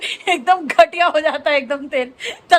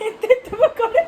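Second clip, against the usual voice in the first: higher, giggling through the words; laughing; 8 semitones higher than usual. Real, not acted. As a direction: crack up at the tiny pakoras. Laughing at tiny pakoras.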